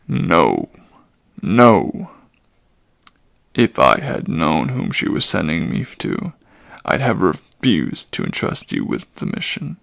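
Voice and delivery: low, loud, vehement centaur; outburst of an old centaur. Acted. Old Chiron refusing the mission, loud and low. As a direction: blurt it out low and hard.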